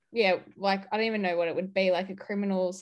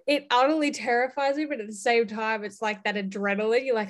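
ordinary talk

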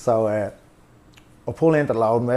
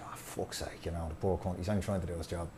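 soft, lower whisper under the breath; whispered self-talk; 5 semitones lower, 12 dB quieter than usual. Retold or imitated. Alone in the car feeling bad about the driver, a lower regretful whisper. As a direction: mutter it to yourself, regretful.

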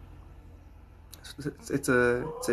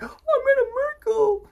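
far higher, hammed-up gasp of wonder; mock awe; 4 dB louder than usual. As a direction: ham up a starstruck believer. Mocking an overblown reaction.

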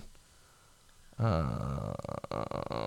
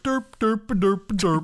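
higher, mock dopey derp; dopey kid noise; 8 semitones higher, much slower than usual. Oblivious boy amid shootout, mock dopey derp. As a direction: play the oblivious boy with headphones. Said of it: "he's just, like, sitting there".

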